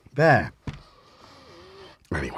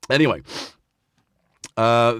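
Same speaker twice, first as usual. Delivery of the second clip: ordinary delivery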